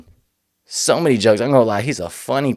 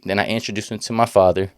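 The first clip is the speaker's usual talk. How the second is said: normal talking voice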